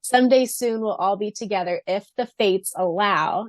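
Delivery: ordinary talk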